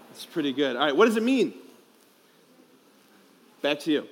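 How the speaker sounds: ordinary talk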